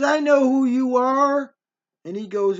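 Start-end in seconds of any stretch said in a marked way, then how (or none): 0.0-1.5 high, loud, slow accusation; drawn-out, knowing challenge; accusing. Retold or imitated. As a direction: imitate a mother who has found someone out. Mother confronts man on phone.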